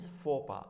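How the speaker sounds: "faux pas" as foh-PAH; correct "faux pas". Shown as a demonstration. Teacher confirming the pronunciation, correct silent endings. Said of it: "Pronunciation is".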